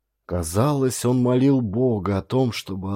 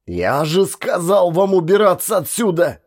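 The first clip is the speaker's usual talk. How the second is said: a mild narrated shout, a little louder and higher; yelling; 8 semitones higher, 5 dB louder than usual. A narrator's reading. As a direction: drive the family out, lightly yelled. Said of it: "заорал". (слегка громче, мнимый крик)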